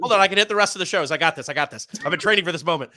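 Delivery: fast, high, hyped-up rush; excited. Real, not acted. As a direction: race in eagerly, all hyped. Jumps in to announce the shows.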